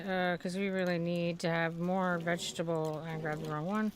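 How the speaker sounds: ordinary talk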